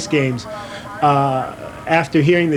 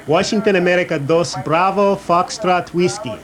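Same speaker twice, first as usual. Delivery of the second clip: higher-pitched Spanish-accented radio call; 4 semitones higher than usual. Shown as a demonstration. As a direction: give the call sign with a put-on Spanish accent. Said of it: "I said with a Spanish accent".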